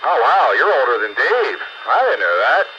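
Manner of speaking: usual speaking voice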